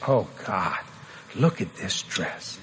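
put-on low, soft young wife admiring something; quiet, drawn-out private admiration; hushed couple talk. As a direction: say it softly, slowly, as private talk. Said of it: "they're just talking like this".

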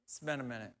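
soft, mumbled admission; sheepish son. Retold or imitated. Grown son confessing to his father, soft and sheepish. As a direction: own up quietly, a bit embarrassed.

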